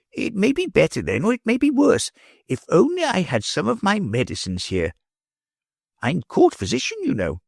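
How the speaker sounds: put-on a fretful old physician fussing over his patient; gently voiced elderly doctor; old court physician.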